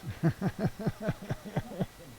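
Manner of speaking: high, rapid giggle; long run of high chuckles; amused laugh. Real, not acted. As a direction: chuckle away at your own pun. Laughs at own Family Games joke.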